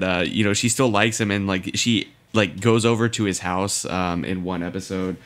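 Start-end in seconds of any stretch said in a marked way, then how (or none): none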